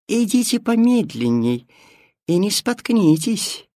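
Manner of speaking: high, sweet cat voice; sly cat voice. Acted. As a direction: coax them along in a high purr. Cat voice calling ahead.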